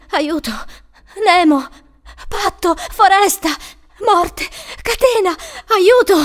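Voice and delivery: high, loud isolated cries; screaming in trance. A narrator's reading. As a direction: shout each word like an alarm. Entranced girl screaming disjointed words.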